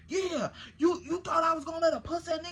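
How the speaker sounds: fast, shouted tough-guy rant; going off. Retold or imitated. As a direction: fire it off in a furious rush. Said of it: "he just going off".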